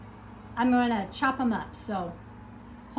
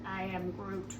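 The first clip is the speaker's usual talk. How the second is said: low, soft, lightly voiced Groot; a hint of the tree creature; Groot impression; 6 semitones lower, 10 dB quieter than usual. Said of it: "who does that look like".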